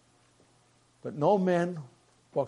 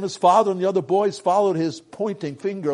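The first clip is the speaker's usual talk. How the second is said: ordinary delivery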